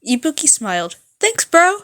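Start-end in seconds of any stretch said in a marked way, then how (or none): 1.2-1.8 put-on high, loud bubbly girl voice; high loud cheerful thanks; chipper rock girl. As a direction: toss it off brightly like a rock star. Ibuki voiced, cheerful reply.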